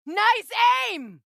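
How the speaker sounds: excited cheer. Acted. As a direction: cheer him on mid-fight. Praising ally in battle.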